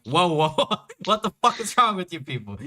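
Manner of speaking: high, fast startled protest; exasperated outburst. Retold or imitated. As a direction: freak out at the group. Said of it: "or he'd be like".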